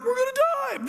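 acted panicked cry; fearful panic. Fishermen in the giant storm crying out, panicked. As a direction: cry out like a frightened crewman. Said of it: "fearing for their life".

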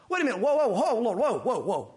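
hammed-up startled whoa; mock alarmed Peter. Peter startled by Jesus, in playful mock alarm. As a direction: stop him short in playful alarm.

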